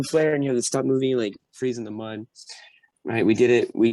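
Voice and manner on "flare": mild mock yell, pitched up; higher, half-raised alarm call; retold scream. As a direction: call it out as an imitated warning. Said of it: "they would scream".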